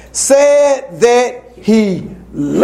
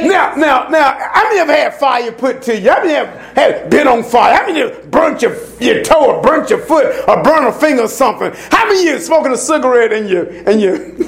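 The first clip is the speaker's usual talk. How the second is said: preaching at full force; 6 semitones higher than usual. Acted. Preacher warning the church about controlling men, full force. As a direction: press the point home from the pulpit.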